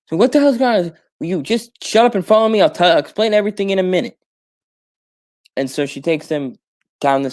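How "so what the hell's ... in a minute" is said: frantic. Acted. Frantic exchange on the street.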